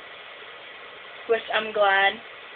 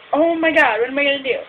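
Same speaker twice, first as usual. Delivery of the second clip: panicked; 4 semitones higher, 6 dB louder than usual. Retold or imitated. Retold panic over loose ball.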